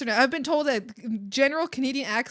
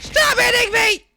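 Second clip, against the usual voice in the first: high, loud shout; sudden loud outburst; 13 semitones higher, 12 dB louder than usual. Pretend. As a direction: yell it in high-pitched protest. Shouts at being hit.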